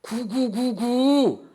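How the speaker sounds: high, loud, drawn-out cooing; pigeon coo. Acted. Grandmother solemnly revealing her password to the clerk, loud pigeon cooing. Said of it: "비둘기 울음소리 구구구구".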